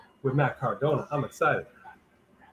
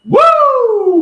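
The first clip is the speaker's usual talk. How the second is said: loud, high "Woo!" shout; a sudden high celebratory whoop; hype yell; 13 dB louder than usual. Real, not acted. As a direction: let out a big hyped-up woo. Host's hype call after hometown shout.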